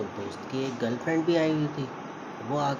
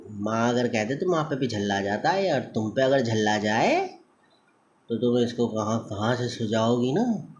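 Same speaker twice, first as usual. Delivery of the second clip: normal talking voice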